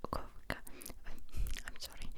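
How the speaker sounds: ordinary talk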